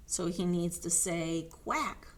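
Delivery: ordinary delivery, nothing marked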